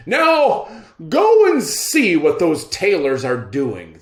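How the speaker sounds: high, loud royal command; pompous emperor giving orders. Acted. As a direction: order the servant about like a vain emperor.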